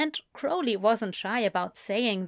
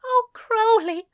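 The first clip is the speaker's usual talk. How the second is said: high, loud, weeping; crying openly; 13 semitones higher, 6 dB louder than usual. A narrator's reading. Weeping angel clings to Crowley, high and crying. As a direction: sob it out in relief. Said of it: "crying openly on his shoulder".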